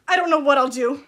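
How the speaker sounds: loud outburst. Acted. Parent's outburst.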